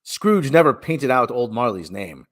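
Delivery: ordinary delivery, nothing marked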